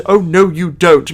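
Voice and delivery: lightly voiced upper-crust clock butler. Reviewer imitating the remake's upper-crust Cogsworth, upper-crust voice. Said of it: "like, an upper crust".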